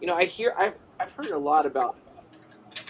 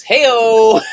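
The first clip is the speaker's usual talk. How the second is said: loud, high drawn-out "hey-o"; big high-pitched joking holler; jokey whoop; 9 semitones higher, 15 dB louder than usual. Real, not acted. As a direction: shout it out like a punchline hit. Whooping at a chat joke.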